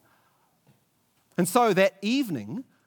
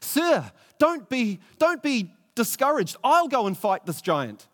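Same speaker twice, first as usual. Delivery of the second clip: higher and a bit louder, eager and upbeat; brightly; 6 semitones higher, 4 dB louder than usual. Acted. David eagerly encouraging the despairing king Saul, brightly. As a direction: cheer up a gloomy king. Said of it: "said brightly".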